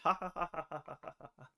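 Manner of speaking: short mirthless ha-ha, fading away; hollow little laugh that dies out; dry sarcastic laugh. Real, not acted. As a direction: laugh it off bitterly, trailing quiet. Sarcastic laugh at healthcare hope.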